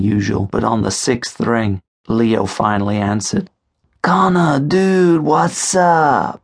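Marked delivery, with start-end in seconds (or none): 4.0-5.2 higher, easy casual greeting; lightly voiced friend playing it cool. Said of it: "he said casually enough". 5.2-6.4 casual. Acted. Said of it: "he said casually enough".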